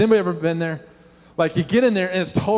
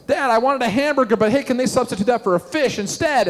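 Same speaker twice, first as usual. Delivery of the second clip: put-on higher-pitched kid asking Dad; raised, eager kid voice; imitated kid; 7 semitones higher than usual. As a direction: mimic a child pestering from the back seat. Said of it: "then you hear things like this".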